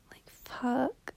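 breathy whispered curse; whispering. Real, not acted. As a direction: whisper it under your breath. Late-night sad confession.

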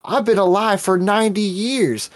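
high-pitched mock elderly complainer; entitled old person. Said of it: "they're just so entitled".

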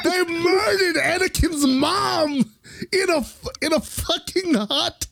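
high, drawn-out wail of disbelief; wailing outrage. Real, not acted. Fan upset about Anakin's mom, wailing outrage. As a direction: cry it out in high-pitched indignation.

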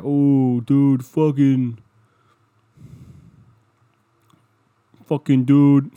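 loud, slow, jeering put-on voice; hammed-up bro jeer; mocking a commenter. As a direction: sneer like a mean commenter.

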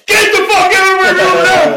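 screaming at full force, high and loud; a teenager screaming at his mother to get out; furious yell. Retold or imitated. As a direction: throw her out of the room with a scream. Retold yelling at his mother.

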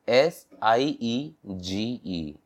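"एफ़ आई आर एम" spelled slowly, letter by letter; slow spelling. Shown as a demonstration. Teacher spelling for learners, slow letter by letter. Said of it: "सीज".

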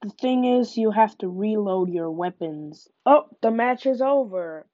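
normal talking voice

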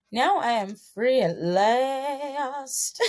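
slow, high triumphant cry; mock-heroic freedom; free at last. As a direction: draw it out like a grand liberation. Triumphant end of story.

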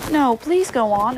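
pleading humbly, pitch lifted; humble. A narrator's reading. Alice begging the Dormouse to continue, humble. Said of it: "said very humbly".

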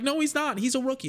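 high, mocking voice; mocking fans. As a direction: whine it like a defensive fan. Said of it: "People were like".

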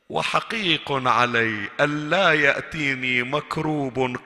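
very slow, weighted hadith reading; slow solemn recitation. Acted. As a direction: lay each word down slowly. يتلو الحديث بتأنٍّ. (تلاوة بطيئة خاشعة)

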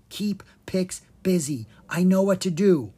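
ordinary delivery, nothing marked

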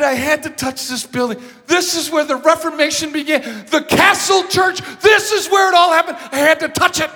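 building from high and loud to a shout; passionate plea. Retold or imitated. Pastor explaining the Reformation to the officer, building fervent plea. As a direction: plead with growing fervour.

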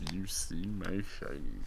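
soft creature voice. Shown as a demonstration. Testing the prompt line, soft creature voice. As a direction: coax it out quietly like a lurking thing. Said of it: "repeat of prompt".

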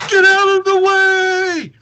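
very high, drawn-out scream of warning; full-out yell. Acted. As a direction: yell it long and loud while leaping. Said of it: "jumps over and yells".

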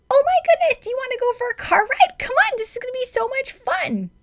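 very high, bright and excited, like talking to a puppy; gushing baby-talk to a nervous dog; super cheerful dog-coaxing voice. Shown as a demonstration. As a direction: coax a scared dog with a sky-high happy voice. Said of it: "I'm gonna use my super cheerful, trying to convince a dog voice".

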